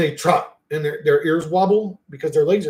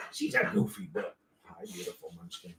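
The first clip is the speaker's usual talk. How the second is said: breathy whispered coo; softly cooing to the boxer; cooing at dog; 8 semitones lower, 12 dB quieter than usual. Real, not acted. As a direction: whisper it lovingly.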